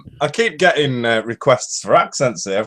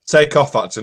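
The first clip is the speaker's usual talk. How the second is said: ordinary delivery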